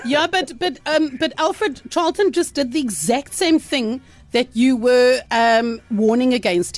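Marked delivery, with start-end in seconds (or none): none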